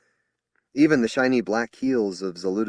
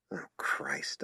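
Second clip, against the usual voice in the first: whispered oath; under breath; 5 semitones lower, 8 dB quieter than usual. Acted. Corporal muttering in dismay, whispered. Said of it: "I said under my breath".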